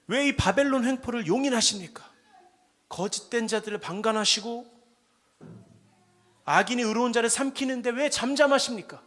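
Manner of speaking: loud, anguished protest to God; pouring out grievance. Acted. Prophet crying out to God, loud anguished protest. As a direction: cry out the injustice, demanding. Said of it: "하나님께 막 울분을 토해요".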